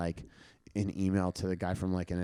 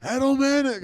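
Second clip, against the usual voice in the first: much higher, louder call of the name; mock shout; 7 dB louder, slower than usual. Imagined guy yells his real name, high and loud. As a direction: yell the name like catching someone out. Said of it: "there's just gonna be a guy be like".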